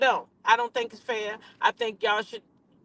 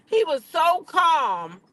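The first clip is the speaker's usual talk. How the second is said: slow drawn-out disbelief; 8 semitones higher, 3 dB louder, much slower than usual. Real, not acted. Emphatic disbelief.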